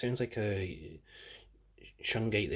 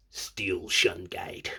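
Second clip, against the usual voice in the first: low, slow announcer voice; a lightly voiced villain name; dramatic villain name; 4 semitones lower, much slower than usual. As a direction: intone it like a wrestling villain. Trying villain names in deep voice.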